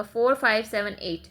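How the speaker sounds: फ़ोर फ़ाइव सेवन एट read as separate digits, one after another. Shown as a demonstration. Teacher showing how to read decimals, each digit said separately. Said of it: "वो गलत होगा".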